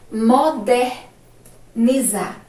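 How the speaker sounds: broken into slow syllable groups; slow dictation. Shown as a demonstration. Teacher dictating to the class, slow broken syllables. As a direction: pause inside "Modernizar" as you dictate.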